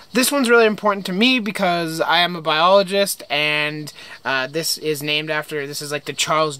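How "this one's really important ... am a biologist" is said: ordinary talk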